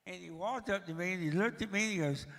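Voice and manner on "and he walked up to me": normal talking voice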